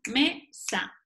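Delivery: mesa said as two separated syllables, me-sa; split into syllables. Shown as a demonstration. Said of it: "sepárala en sílabas".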